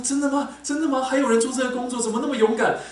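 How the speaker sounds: high, loud astonished questions; mock amazed. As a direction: imitate wide-eyed disbelief. Retells coworker's amazed reaction. (高声惊讶地模仿同事)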